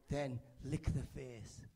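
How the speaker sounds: ordinary talk, nothing marked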